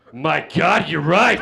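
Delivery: loud, aghast cry; horrified realization. Retold or imitated. Guy on acid pulls his hand back, horrified realization. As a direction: cry it out in mock horror. Panicked reaction to his hands.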